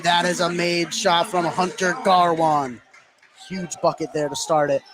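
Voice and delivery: normal talking voice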